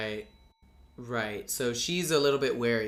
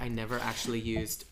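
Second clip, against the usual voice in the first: normal talking voice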